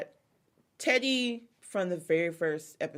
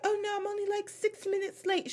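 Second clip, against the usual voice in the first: high-pitched airy excuse in a put-on accent; Dorit's fake mixed accent; 14 semitones higher than usual. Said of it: "every time I do her impression".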